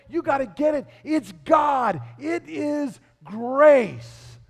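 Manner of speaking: insistent and loud, slowing hard on "grace"; emphatic interjection. Acted. Paul stopping his dictation to insist, emphatic interjection. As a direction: drive it home, stretching "grace". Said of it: "Paul stops what he's saying to say".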